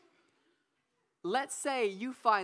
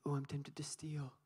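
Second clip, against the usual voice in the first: mock whisper; 7 semitones lower, 11 dB quieter than usual. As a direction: whisper it like a naughty confession. Mock confession of temptation.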